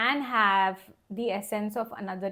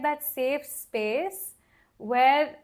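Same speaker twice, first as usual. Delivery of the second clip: ordinary delivery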